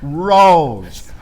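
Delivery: ordinary delivery, nothing marked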